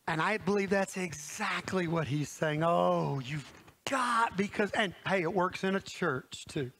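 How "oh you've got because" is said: ordinary delivery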